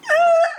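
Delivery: high loud mock wail; a sudden hammed-up sob-cry. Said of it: "So I was like, gasps".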